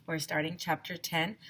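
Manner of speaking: plain reading voice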